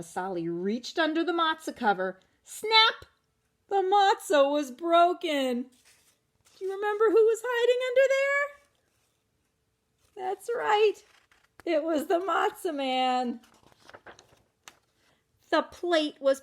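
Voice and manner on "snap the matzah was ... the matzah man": high, loud excited reveal; dramatic snap. Acted. Reader telling children the matzah broke, high and loud. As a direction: announce the break with a gasp.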